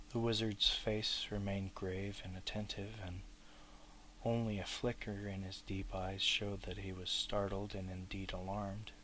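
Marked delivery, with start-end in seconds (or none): none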